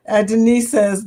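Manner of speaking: normal talking voice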